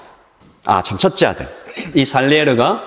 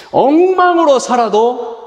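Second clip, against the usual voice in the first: high, loud and drawn out, a slower peak; emphatic preaching cry; 13 semitones higher, 4 dB louder, slower than usual. Real, not acted. Pastor stresses God loves the messy sinner, emphatic. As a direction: lift the congregation with it.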